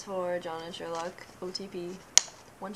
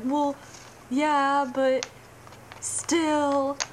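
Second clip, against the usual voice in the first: high, loud, whiny comeback; mock plaintive protest; 7 semitones higher, 5 dB louder, much slower than usual. Fan protesting to dad about crying, mock plaintive. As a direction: whine back in a raised, drawn-out voice.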